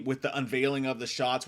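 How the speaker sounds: usual speaking voice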